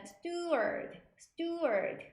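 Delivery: "steward" pronounced clearly for learners; pronunciation demo. Shown as a demonstration. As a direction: model the word "steward", t without a puff. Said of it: "이런 발음이 되는데". ("steward"를 또박또박 발음 시범)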